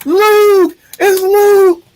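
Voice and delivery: high, loud whooping cheer; shrill-pitched crowd-style cheering; mock fan cheering. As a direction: scream it like an overjoyed fan. Acts out cheering fans after applause.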